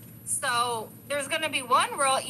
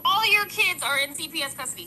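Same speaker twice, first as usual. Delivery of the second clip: raised, higher-pitched yell; louder, higher, passionate outburst; 4 semitones higher, 4 dB louder than usual. Real, not acted. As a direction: get worked up and raise your voice. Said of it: "Sorry, I'm yelling".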